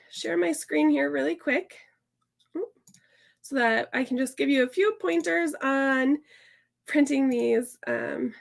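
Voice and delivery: ordinary talk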